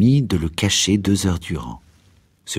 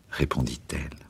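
ordinary delivery, nothing marked

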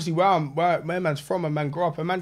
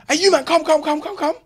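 high, louder rapid summons; calling people over; 9 semitones higher, 3 dB louder than usual. Retold or imitated. Retelling him beckoning youths from Nando's, high urgent call.

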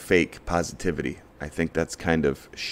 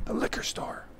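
breathy whispered aside; 13 dB quieter than usual. Acted. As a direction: drop to a whisper for the punchline. Whispered punchline of the rant.

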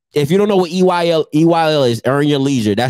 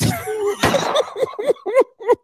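high laugh; 4 dB quieter than usual. Real, not acted.

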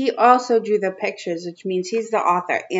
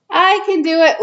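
put-on higher, excited kid answer; little kid voice; 7 semitones higher, 5 dB louder than usual. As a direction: burst in like an excited child. Child voice in picture book.

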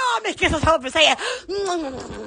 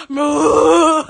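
loud mooing siren call; cow-like siren moo; 3 semitones lower, 6 dB louder than usual. Retold or imitated. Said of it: "ours sounded like a cow".

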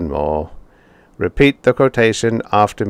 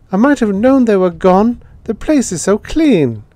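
put-on high, louder boy's voice, realising; resigned boy; 13 semitones higher, 5 dB louder than usual. A narrator's reading. Boy noticing the clean house, high and resigned. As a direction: say it like a boy putting it together.

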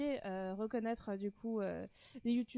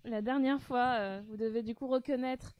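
radio-style presenter voice; game-host voice; 4 dB louder than usual. Pretend. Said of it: "Pourquoi tu prends cette voix".

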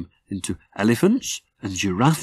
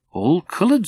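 high, full of wonder; a small doll delighted; delighted puppet voice; 8 semitones higher than usual. A narrator's reading. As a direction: gasp at the colours. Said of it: "said Rosie".

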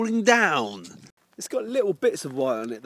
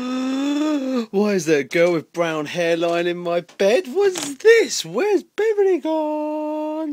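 mock surprised voice; 9 semitones higher, 4 dB louder, much slower than usual. Waking partner in mock voice.

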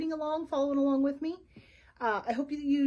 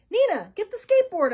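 eager order; 8 semitones higher, 10 dB louder than usual. A narrator's reading. Detective orders his assistant.

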